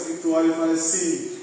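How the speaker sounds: ordinary delivery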